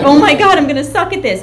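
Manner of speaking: loud, high inner panic; panicked, near tears. Retold or imitated. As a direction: replay a rookie's dread, pitched high and loud. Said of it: "like, ready to cry".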